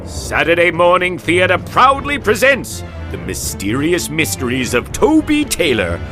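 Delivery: normal talking voice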